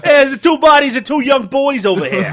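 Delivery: loud, brash Long Island reporter; Long Island accent. Pretend. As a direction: shout it like a New York field reporter. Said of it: "that Long Island accent".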